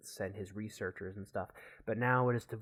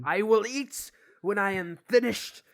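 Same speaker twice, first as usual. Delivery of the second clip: loud, high shout of a stubborn scientist; angry shouted refusal; 11 semitones higher, 10 dB louder than usual. Acted. Gavin snaps at nagging friend, angry shout.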